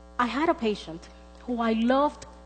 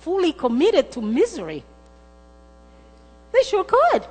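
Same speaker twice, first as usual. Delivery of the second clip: rising, much higher and louder preacher's cry; fiery preaching; 9 semitones higher, 8 dB louder than usual. Acted. Preacher crying out to the congregation about misery, in full force. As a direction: build the congregation up to a shout.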